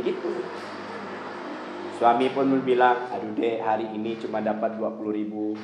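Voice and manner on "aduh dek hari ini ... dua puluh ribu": soft, low, apologetic husband; tired husband. Retold or imitated. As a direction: say it softly, ashamed of the small pay. Suami lapor penghasilan kecil. (suami lelah berbicara pelan)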